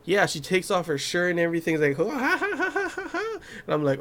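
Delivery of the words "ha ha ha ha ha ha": mock laugh climbing very high; imitated high cackle. Imitates a medic's wild laughter.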